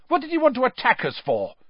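fast, high indignant protest. A narrator's reading. Ron protesting to his father, fast and indignant. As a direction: protest the unfairness quickly. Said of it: "looking indignantly at his father".

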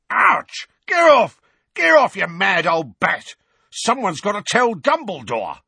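loud, high yelps; yelping in pain. A narrator's reading. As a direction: cry out under blows. Mundungus being whacked.

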